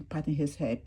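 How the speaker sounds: ordinary delivery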